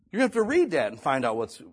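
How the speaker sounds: ordinary delivery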